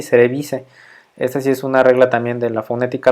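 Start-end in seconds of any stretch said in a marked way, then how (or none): 0.0-0.7 cerevisiae said as "se-re-BI-se"; Latin pronunciation. Shown as a demonstration. Teacher showing students the Latin rule, cerevisiae pronounced "se-re-BI-se". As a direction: end cerevisiae on a plain "se", dropping the A. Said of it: "Esta es su pronunciación también es así".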